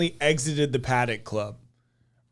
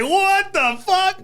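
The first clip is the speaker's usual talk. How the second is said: high, stretched-out incredulous cry; retold disbelief; 5 dB louder, much slower than usual. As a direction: draw it out in mock amazement. Retold disbelief at the bouncer's phrase.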